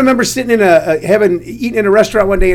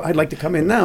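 much softer, low matter-of-fact reply; dry deadpan; 3 semitones lower, 7 dB quieter than usual. Retold or imitated. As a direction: say it flat and quietly, straight-faced. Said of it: "I go".

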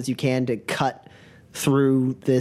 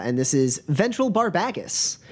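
usual speaking voice

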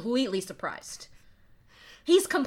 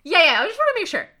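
anxious double check; 7 semitones higher, 10 dB louder, faster than usual. Retold or imitated. Voicing Mel Gibson double checking.

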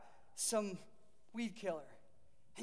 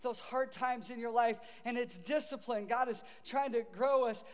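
ordinary talk, nothing marked

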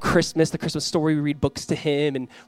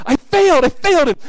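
high, loud comic wail; hammed-up panicking parent; mock parental despair; 12 semitones higher, 8 dB louder than usual. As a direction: cry out in exaggerated defeat. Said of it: "as a parent, you're like".

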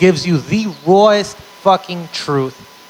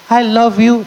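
higher-pitched mock wife; imagined loving wife; 6 semitones higher than usual. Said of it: "She's not gonna say".

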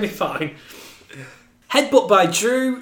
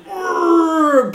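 flexing grunt; 10 semitones higher than usual. Retold or imitated. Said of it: "the Hillbilly Jim".

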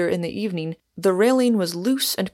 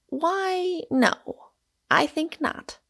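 high-pitched, musing drawl with pauses; leisurely, lofty musing in a lifted voice; meditative; 10 semitones higher, slower than usual. A narrator's reading. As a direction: ponder airily, then decline. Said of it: "after some meditation".

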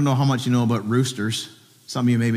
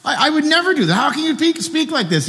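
much higher, protesting as Peter; indignant Peter; 13 semitones higher than usual. Retold or imitated. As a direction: play Peter, insulted at the very idea. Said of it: "He's like".

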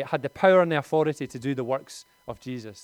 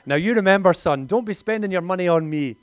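a hint of an insistent mum; lightly voiced mum, raised; mother's voice; 4 semitones higher, 8 dB louder than usual. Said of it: "I was gonna do a Northern Irish accent. I don't think I will".

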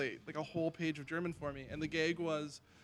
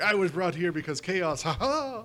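louder, hammed-up movie scientist; mock movie-character quote; 3 semitones higher, 7 dB louder than usual. Host acting out a movie scientist for laughs, hammed-up. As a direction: put on a showy voice, rising into a fake laugh.

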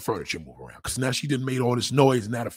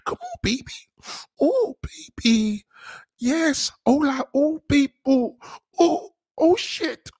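very high, crying-out fake moans; mock accented moaning; 3 dB louder, slower than usual. A comic mocking a Spanish woman's show.

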